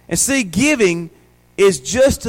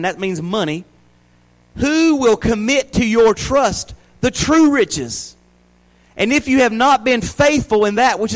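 raised, deliberate Bible reading; scripture reading; 4 semitones higher than usual. Acted. Pastor reading the next verses to the church, raised and deliberate. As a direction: read the verses out to the congregation.

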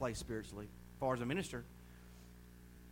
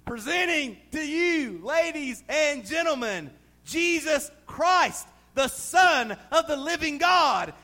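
slow, much louder and higher grand announcement; grand announcer; 10 semitones higher, 10 dB louder, slower than usual. Acted. Preacher announcing Jesus to the congregation, slow and booming. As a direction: announce the King of kings to the crowd.

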